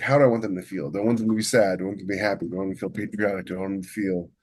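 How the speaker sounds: ordinary delivery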